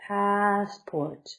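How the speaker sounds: Passport stretched, PASS high and strong, port low; slow teaching model of Passport; high-then-low stress demo. Shown as a demonstration. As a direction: punch pass and drop port. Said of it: "down low".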